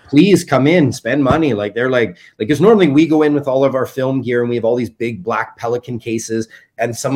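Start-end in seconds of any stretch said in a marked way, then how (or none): 0.1-1.5 mock welcome. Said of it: "Now they're like".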